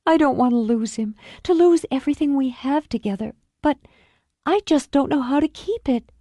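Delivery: high, strained pleading woman; a wife afraid of losing her husband; tremulous plea; Julie, anxious and pleading. Acted. Julie pleads, voiced higher.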